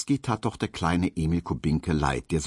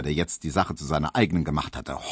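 ordinary delivery, nothing marked

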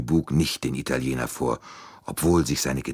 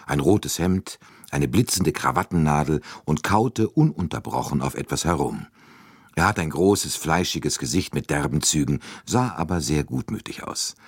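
plain reading voice

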